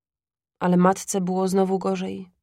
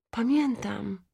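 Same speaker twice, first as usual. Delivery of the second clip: szeptem; 3 semitones higher, 5 dB quieter, slower than usual. A narrator's reading. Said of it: "szepnęła matka".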